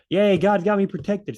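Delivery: a silly believer cheering before doing stupid stuff; mock cheer. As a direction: play the reckless fool cheering. Mocking careless overconfidence.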